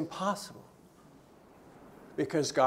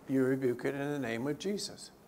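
ordinary talk

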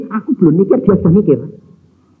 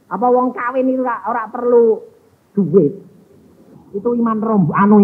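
ordinary talk

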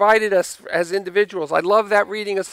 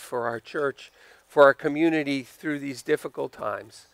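closing prayer; 6 semitones lower than usual. Real, not acted. Pastor leads closing prayer.

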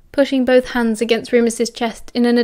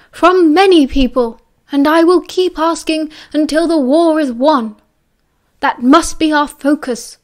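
put-on louder, higher, stern old headmaster; firm, raised voice; 5 semitones higher, 4 dB louder than usual. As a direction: lay down the law. Dumbledore no longer kind.

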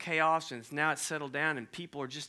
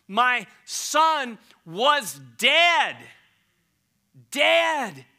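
slow, much louder, leaning hard on dead; slow proclamation; 7 semitones higher, 10 dB louder, much slower than usual. Acted. Father announcing to servants, slow loud proclamation. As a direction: draw out each word like a herald.

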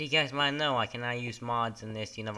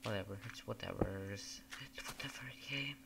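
shrugging whisper; dismissive whispered aside; 11 dB quieter than usual. Real, not acted. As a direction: let it fall away in a whisper. Whispered shrug while playing.

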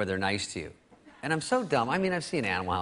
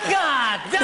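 laughing self-mock; 12 semitones higher, 5 dB louder than usual. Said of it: "He's like".

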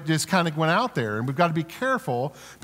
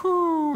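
relaxed sigh; 12 semitones higher than usual. Shown as a demonstration. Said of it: "Everybody do that".

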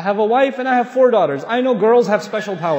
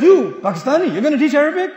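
higher, louder mock-indignant questioning; acted-out scornful challenge, pitched up; 6 semitones higher, 6 dB louder than usual. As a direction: imitate an affronted man challenging you. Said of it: "they let their anger out on you".

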